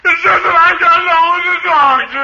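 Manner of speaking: high, tearful comic lament; hammed-up wailing. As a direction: wail it like a man breaking down. Henpecked friend breaks down complaining.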